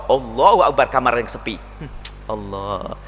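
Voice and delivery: a man praying alone after showing off; quiet private prayer. Retold or imitated. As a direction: pray alone in an empty room. Praying alone in empty room.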